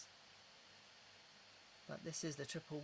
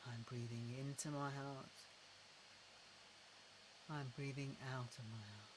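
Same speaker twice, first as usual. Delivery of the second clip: unhurried, gentle repetition into the heart; guided breathing; 2 semitones lower, 7 dB quieter than usual. Shown as a demonstration.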